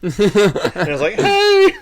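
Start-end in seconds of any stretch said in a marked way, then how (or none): 1.1-1.7 very high, loud squeal of a hello; mock-cheery greeting. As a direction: squeak out an over-the-top greeting. Greets couple after giving a look.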